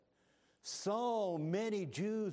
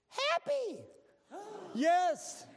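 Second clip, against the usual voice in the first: much higher and louder, with a gasp; shocked gasp; 6 dB louder, much slower than usual. Acted. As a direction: burst out in staged amazement. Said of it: "a statement that's gonna make you gasp".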